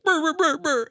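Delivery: high, loud lip-trill grumble; mock sulky grumble. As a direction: sulk out a high brr brr like a grumpy kid. Upset kid grumbling at police.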